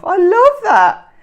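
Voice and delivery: high, loud, slow "I love that"; delighted. Real, not acted. Reacting to a Devon phrase, high loud delight. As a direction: gush over the phrase. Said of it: "delight at phrase".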